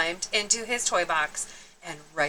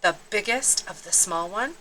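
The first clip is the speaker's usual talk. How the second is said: ordinary delivery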